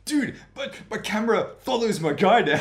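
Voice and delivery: excited. Retold or imitated. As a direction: gush with laughing glee. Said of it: "be like".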